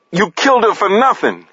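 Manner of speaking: high-pitched grieving outburst; anguished accusation. A narrator's reading. Jay accusing Paris over a killing, anguished.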